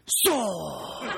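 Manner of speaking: long, falling mock battle cry; Beckett drawing his sword. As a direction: imitate a kid's drawn-out sword-draw noise. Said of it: "he does this".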